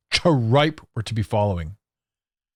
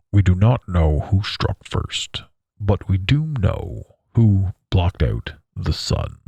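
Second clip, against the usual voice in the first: slow, lower, solemn movie-mentor delivery; Morpheus quote; 6 semitones lower, slower than usual. Retold or imitated. Quoting The Matrix to mock sun blocking, slow and solemn. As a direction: intone it like a grave prophet. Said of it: "Morpheus is quoted as saying".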